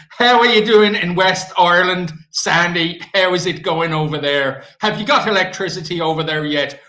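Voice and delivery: loud mock hail across a distance; calling out. Streamer hails Sandy in West Ireland, calling out loudly. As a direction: call it out loudly, playfully.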